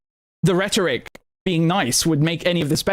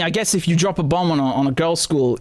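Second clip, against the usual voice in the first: ordinary delivery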